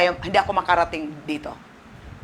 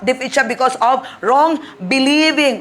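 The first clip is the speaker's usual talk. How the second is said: slow, rising, ever louder cry; building preach; 7 semitones higher, 5 dB louder, slower than usual. Acted. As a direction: hammer each wrong harder. (pasigaw na pangangaral)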